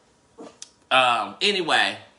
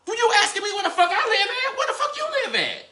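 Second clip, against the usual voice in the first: much higher, laughing disbelief; incredulous retort; faster than usual. Retold or imitated. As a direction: fire it back in high mock outrage. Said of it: "the little boy asked him back".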